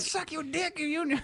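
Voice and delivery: tiny high put-on voice; soft, squeaky mock-earl falsetto; Earl of Sandwich. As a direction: toss it off very high and lightly. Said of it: "He talks like that".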